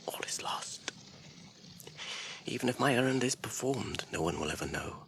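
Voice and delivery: low hushed whisper of hopelessness; despairing whisper. Acted. Frodo alone on the stairs, whispering in despair. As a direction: let the hope drain out.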